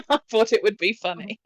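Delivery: ordinary delivery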